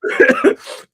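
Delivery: high breathless laugh; real burst of laughter; unvoiced giggle fit. Real, not acted. Cracking up at own story.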